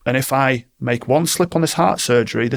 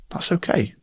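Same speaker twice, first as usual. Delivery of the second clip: gentle reassurance; 6 semitones higher, 4 dB quieter than usual. Retold or imitated. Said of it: "remain respectful of how they are".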